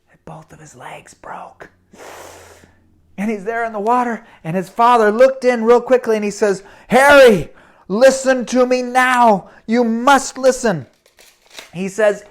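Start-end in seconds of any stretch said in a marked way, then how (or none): 0.1-1.7 breathy storyteller whisper. A narrator's reading. Dramatic moment in the story. 6.9-10.9 urgent father. Acted. Father calls down the pipe.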